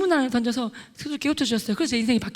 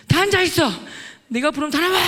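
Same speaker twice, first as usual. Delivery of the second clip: loud, high commanding yell; shouted order; 4 semitones higher, 5 dB louder than usual. Retold or imitated. Student ordering a whole class to sit, shouted command. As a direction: bellow it across a classroom.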